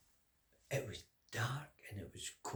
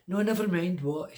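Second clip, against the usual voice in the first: impatient king; 6 semitones higher, 9 dB louder than usual. Acted. King cutting him off.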